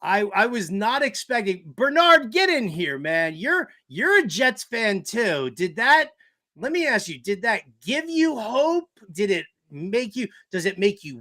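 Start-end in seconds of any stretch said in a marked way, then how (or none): none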